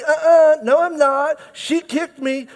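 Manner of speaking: put-on high indignant kid denial; whiny tattling little sibling. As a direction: protest like a bratty kid. Kids fighting in car.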